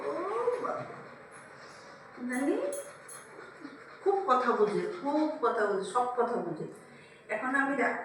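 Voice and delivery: usual speaking voice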